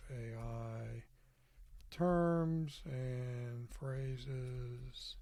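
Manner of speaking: slow, stretched words with long gaps; drawn-out, halting dictation; dictating while typing. Real, not acted. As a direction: speak slowly as you type each word.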